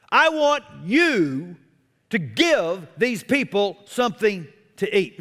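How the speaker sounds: "I want you" drawn out slowly, then the rest of the line brisk; slow down hard on "I want you"; slow insistent command. Retold or imitated. Jesus pressing his disciples to feed the crowd, slow and insistent. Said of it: "Jesus was being very specific".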